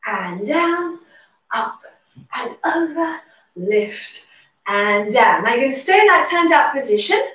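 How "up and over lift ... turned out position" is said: normal talking voice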